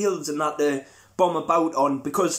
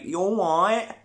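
slow Kent twang; 4 semitones higher than usual. Retold or imitated. Man from Kent answering with a slow, stretched Kent drawl. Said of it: "the guy from Kent will be like".

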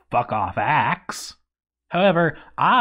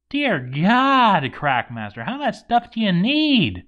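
exasperated disbelief; 8 semitones higher than usual. Real, not acted. Exasperated at the hoarding dwarf.

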